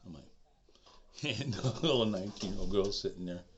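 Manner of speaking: usual speaking voice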